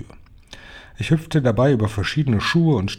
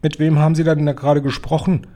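higher-pitched, sharp questioning; snapping demand; 5 semitones higher, 4 dB louder than usual. A narrator's reading. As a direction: demand an answer from her, mildly angry. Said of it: "fuhr sie die Hoch".

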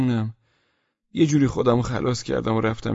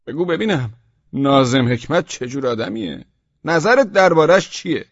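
probing commander; 3 semitones higher, 6 dB louder than usual. Acted. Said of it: "از من پرسید".

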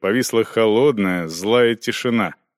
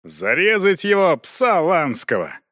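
higher, angry yell of the blacksmith; 7 semitones higher than usual. A narrator's reading. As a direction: blacksmith yells back at the knight in anger. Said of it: "орал кузнец".

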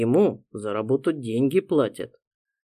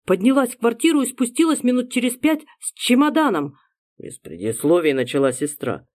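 higher, urgent sister voice; anxious young woman; 11 semitones higher, 4 dB louder than usual. Acted. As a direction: rush it out, worried. Frightened sister reports news.

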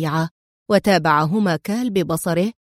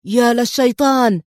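overwhelmed exclamation; 3 semitones higher, 4 dB louder than usual. A narrator's reading. The boss reacting to the photo, shocked outburst. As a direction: burst out in shock, lightly acted. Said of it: "هتف الزعيم في انفعال طاغ".

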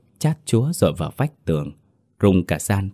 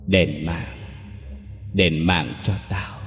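hollow, menacing vengeful spirit; a dead woman demanding revenge; eerie ghost voice; 4 dB quieter than usual. A narrator's reading. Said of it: "câu nói vang dội âm u". (giọng ma âm u)

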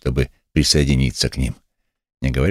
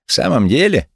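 high-pitched, mocking question; insolent sneer; 7 semitones higher than usual. A narrator's reading. Lame man taunts the angry pastor, insolent sneer. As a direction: ask it with cheeky scorn. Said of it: "с наглой усмешкой".

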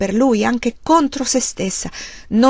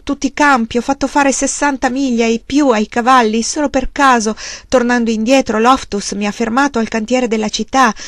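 bitter outburst; 3 semitones higher, 4 dB louder than usual. Acted. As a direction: pour out the failure in anger. Charles vents about debts.

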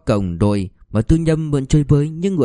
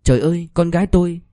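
high, fast anguished cry of a mother; bursting into tears; 6 semitones higher, faster than usual. A narrator's reading. Tư Nhâm hears her daughter ran off, crying out. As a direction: wail it out as the tears break. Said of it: "òa khóc".

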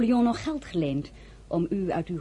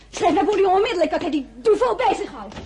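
exasperated laughing outburst; 12 semitones higher, 6 dB louder than usual. Acted. Exasperated at another suitor.

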